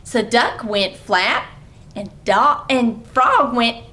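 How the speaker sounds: put-on bright read-aloud for small kids; lively story reading. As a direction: read it like a playful picture-book story time. Reading picture book aloud.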